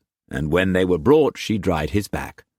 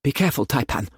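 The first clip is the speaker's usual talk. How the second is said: breathless; 8 semitones higher, much faster than usual. A narrator's reading. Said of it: "she said breathlessly".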